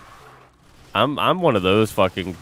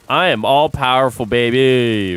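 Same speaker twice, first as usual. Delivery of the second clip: louder, grand mock boast; mock proclamation; 6 dB louder than usual. Streamer boasts to chat of his powers, louder mock proclamation. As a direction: proclaim it like a cartoon villain. Jokingly claims to sway US elections.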